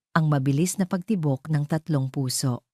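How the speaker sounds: plain reading voice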